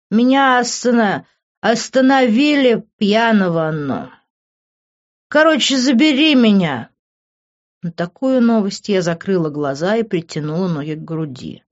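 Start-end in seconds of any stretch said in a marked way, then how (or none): 0.1-6.9 put-on slurred, stumbling drunk man; raised, sloppy tipsy whine; drunken mumbling. A narrator's reading. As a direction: drag it out like a pleading drunk. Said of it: "продолжал он мямлить".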